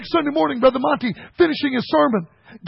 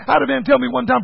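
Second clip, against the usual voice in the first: normal talking voice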